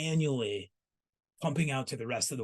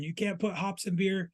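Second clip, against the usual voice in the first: bad German accent; 7 semitones higher than usual. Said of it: "Terrible, uh, German accent there".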